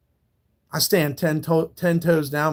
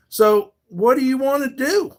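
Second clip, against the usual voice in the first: challenging; 7 semitones higher, 3 dB louder than usual. Real, not acted. Challenging a threatening troll.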